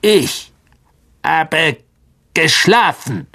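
high, grumbling creature voice; a woken monster complaining; irritated spider. A narrator's reading. Aragog complains of being woken, irritated creature voice.

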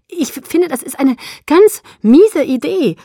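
gasping, high and loud; high, loud, breathless protest; gasped. A narrator's reading. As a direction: stammer it out breathlessly, hitting key words hard. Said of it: "keuchte ich".

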